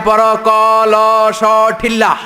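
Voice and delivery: put-on Chittagong teacher accent. Preacher mimics a Chittagong teacher spelling out a word, hammed up. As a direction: ham up a village teacher's dialect spelling. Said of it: "আবার বানান করায়".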